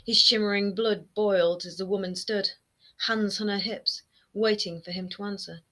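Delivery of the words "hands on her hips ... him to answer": ordinary delivery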